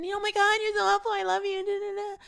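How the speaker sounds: high-pitched, gushing imitation of the girl; mock her sugary gratitude up high; squealing fake-sweet impression of a coworker. Gushing coworker retold in quote.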